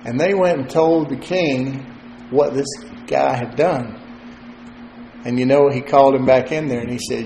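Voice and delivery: usual speaking voice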